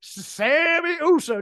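SAMMY USO stretched out slow and high; imitated emphatic delivery. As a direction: drag out "Sammy Uso" with mock relish, pitch lifted. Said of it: "just the way he delivers that".

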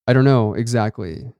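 usual speaking voice

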